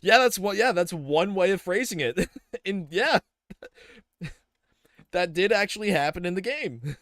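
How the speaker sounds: high, loud, laughing through the words; amused and loud, breaking into laughs. Real, not acted. Laughing at a chat comment.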